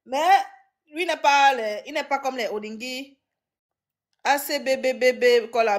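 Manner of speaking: ordinary talk, nothing marked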